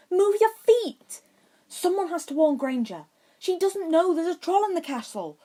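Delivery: stubborn. A narrator's reading. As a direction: dig your heels in. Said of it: "Harry said stubbornly".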